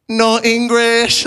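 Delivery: playing the non-English grandson; mock deadpan. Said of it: "I was forced to be like".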